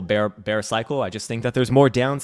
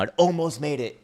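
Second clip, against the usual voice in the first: mock lament; 6 semitones higher than usual. Mourning Bitcoin nearly holding six digits.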